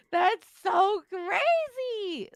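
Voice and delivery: high, loud and very slow, drawn out; laughing friend marvels at riders' stupidity, drawn out and loud; amazed. Real, not acted. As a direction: stretch it out in disbelief. Reacting to careless riders' feet.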